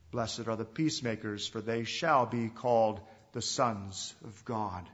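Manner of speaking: measured, unhurried reading of the Beatitudes; scripture reading. Acted. Preacher reads the Beatitudes to the congregation, solemn reading. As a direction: read each blessing as sacred text.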